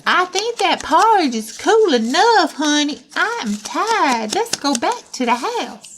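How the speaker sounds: high, lilting mama bear voice. Acted. Mama Bear to her honey about going home, high and tired.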